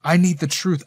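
ordinary delivery, nothing marked

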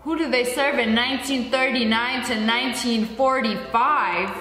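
normal talking voice

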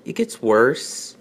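normal talking voice

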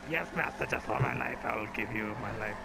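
lightly fawning servant; a hint of groveling servant. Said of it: "for your service".

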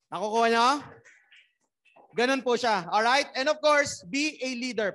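loud, pumped-up crowd rally; loud rally cry. Real, not acted. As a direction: fire up the room like a rally.

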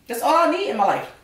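high delighted sigh; blissful. Real, not acted. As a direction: swoon over the food. Savoring the food.

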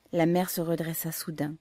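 usual speaking voice

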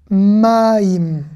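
"maïm" very slowly, syllable by syllable; drawn-out syllables. Shown as a demonstration. Hebrew teacher sounding out the word for water, drawn-out syllables. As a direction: stretch "maïm" into a long maa-iim. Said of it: "on va faire consonne plus voyelle".

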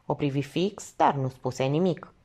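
ordinary delivery

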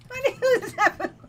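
high, loud, rattled shouting; panicked questions. Real, not acted. Streamer yelling at the creature that jumped out, frightened. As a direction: demand answers in a panic. Right after the jump scare.

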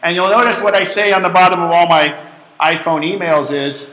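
usual speaking voice

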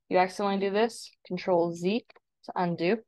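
ordinary talk

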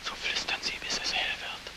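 whisper. Acted. Whispered close of vision.

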